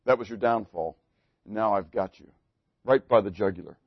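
low and soft, savoring the trap; menacing gloat. Acted. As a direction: drop into a quiet, threatening purr. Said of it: "That would be Bob".

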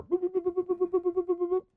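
very high pinging beeps; ping like a little sonar; sonar boops. Pretend. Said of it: "that little sonar".

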